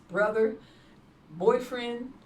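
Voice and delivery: ordinary talk, nothing marked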